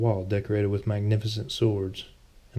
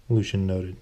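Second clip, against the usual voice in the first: ordinary delivery, nothing marked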